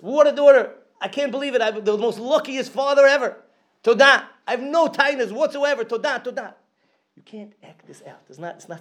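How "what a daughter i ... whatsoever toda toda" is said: high, elated praise of his daughter; elated father. Retold or imitated. Father praising his lost daughter, high and elated. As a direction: sing out his luck, high and bright. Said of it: "And you start talking about".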